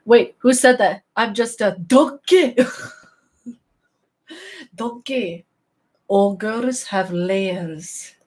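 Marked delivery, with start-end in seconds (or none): 1.9-8.1 drawn-out Shrek impression; Shrek movie impression. Quoting Shrek to stream viewers, playful Shrek impression. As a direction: imitate Shrek, words stretched out. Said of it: "Best moment of Shrek".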